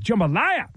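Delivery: "Jambalaya" with a long rolling ZHUM-buh-LIE-uh; drawn-out jambalaya. Shown as a demonstration. Host demonstrating the word for listeners, savored rising. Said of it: "You gotta say it like that".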